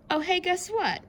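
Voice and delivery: high excited call; high surprised exclaim. Acted. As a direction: grab the kids' attention.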